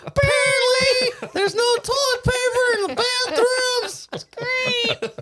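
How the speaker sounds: put-on very high, slow child's wail; mock little kid. Friend's day mocked as stuck kid, high wailing voice. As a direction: squeal it like a stranded whining kid.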